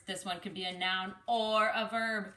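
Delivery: usual speaking voice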